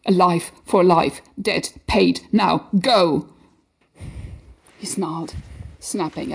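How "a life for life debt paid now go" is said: loud desperate command; urgent shout. Acted. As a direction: shout him away. Urging him to flee.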